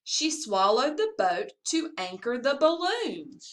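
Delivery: ordinary delivery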